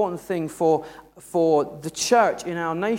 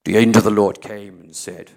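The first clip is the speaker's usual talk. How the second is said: mock booming angel voice with echo, much deeper at the end; 8 semitones lower, 5 dB louder than usual. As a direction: ham up a grand heavenly echo. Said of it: "automatic echo in his voice".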